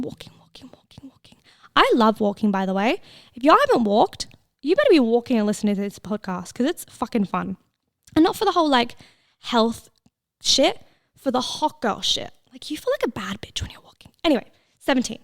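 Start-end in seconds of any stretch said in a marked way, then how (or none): 0.0-1.4 whisper. Shown as a demonstration. Fading footsteps chant. 12.5-14.1 whisper. Real, not acted. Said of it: "confiding aside".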